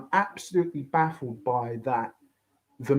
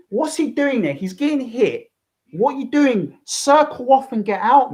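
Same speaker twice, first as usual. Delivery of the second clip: higher, louder yelling at the fighter; exasperated yelling; 7 semitones higher, 5 dB louder than usual. Real, not acted. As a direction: shout advice at the screen in disbelief. Yelling advice at Conor on screen.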